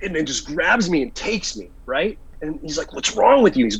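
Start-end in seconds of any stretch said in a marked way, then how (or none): 2.9-3.6 high, raised-voice retold reproach; appalled scolding. Buddy dragging his friend off, appalled scolding. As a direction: imitate a friend aghast at you.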